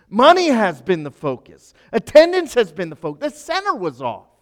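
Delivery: loud, driving preacher cadence; preaching at full force. Acted. Pastor to congregation on misplaced focus, preaching at full force.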